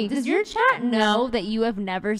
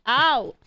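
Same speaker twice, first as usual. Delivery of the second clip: sudden pain yelp; 5 semitones higher, 8 dB louder than usual. Real, not acted. Dog chewing my leg.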